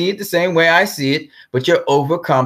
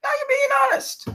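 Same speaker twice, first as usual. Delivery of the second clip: much higher, incredulous retold outburst; exasperated exclaim. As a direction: throw it back at him, half laughing. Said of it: "And I was like".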